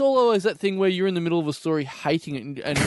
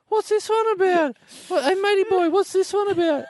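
high nasal-free squeaky pester, much higher than his own voice; pestering weirdo customer; 14 semitones higher than usual. Retold or imitated. Comic shop weirdo pointing at every comic, high pestering voice. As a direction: needle the shop guy in a high silly voice. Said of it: "and go".

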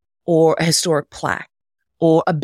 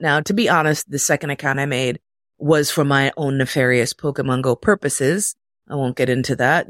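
ordinary delivery

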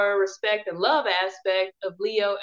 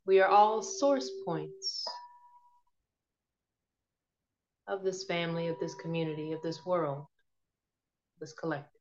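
guided meditation; 5 dB quieter than usual. Acted. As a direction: ease them gently into calm.

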